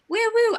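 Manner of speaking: high, loud "woo woo" in place of a wolf whistle; imitated catcall whistle. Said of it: "I can't whistle".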